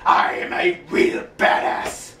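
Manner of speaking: slow, rasping menacing villain voice; Lord Zed impression. Fan imitating Lord Zed from Power Rangers, slow and rasping. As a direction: growl it out slowly like a TV supervillain. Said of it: "Lord Zed was originally all".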